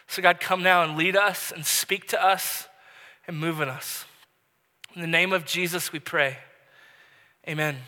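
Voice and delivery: hushed pulpit prayer. Real, not acted. Pastor closing a prayer.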